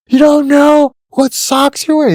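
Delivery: high, slow, mocking drill instructor; hammed-up drill sergeant taunt. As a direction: needle the recruit like a sneering DI.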